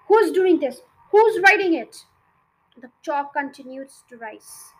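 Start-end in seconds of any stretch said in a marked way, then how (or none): 0.1-2.0 loud, high furious demand; yelling. A narrator's reading. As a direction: bellow it at the boy. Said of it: "then she yelled at him".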